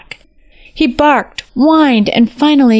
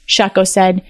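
plain reading voice

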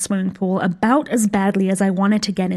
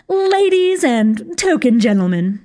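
grand hostess; 4 semitones higher, 4 dB louder, slower than usual. A narrator's reading. Witch hostess toasting guests.

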